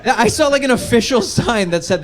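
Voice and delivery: ordinary talk, nothing marked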